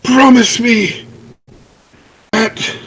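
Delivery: high, melodramatic dying gasp; having a heart attack. Acted. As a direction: ham up a fake death scene. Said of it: "dramatically clutch my chest".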